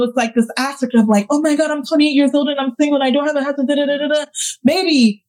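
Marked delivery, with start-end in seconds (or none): 1.3-4.2 fast, high, mocking voice of a complaining single woman; mock whiny complaint. Mocking the single woman who worries about her age, in a high whiny voice. 4.6-5.2 higher, teasing, straight-talking; a touch of tough love. Real, not acted. Telling her younger self to stop worrying, lightly teasing. Answers the complaint, talking to herself.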